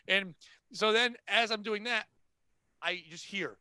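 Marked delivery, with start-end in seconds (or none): none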